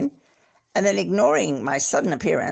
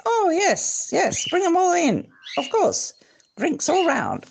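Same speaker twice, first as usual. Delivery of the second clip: jovial host; 13 semitones higher than usual. Acted. Host inviting everyone in, very high and jovial. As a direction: wave them all in, high and jolly.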